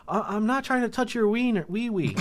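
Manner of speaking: high, loudish protest in mock voice; pleading man impression. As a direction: imitate a pitiful man protesting innocence. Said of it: "and he goes".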